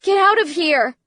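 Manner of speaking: loud, high-pitched yell; frantic shouted order; yelling back. A narrator's reading. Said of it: "she yelled back".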